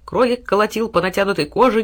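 plain reading voice

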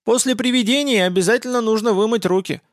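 high, fussing granny voice; old woman. Acted. Old woman advises the hunters.

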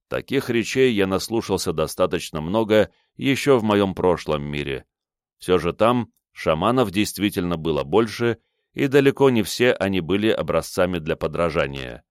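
plain reading voice

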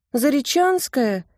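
high, slow plaintive question. A narrator's reading. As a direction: ask it pitifully, almost whining. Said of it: "Тонечкин голос звучал жалобно". (жалобно)

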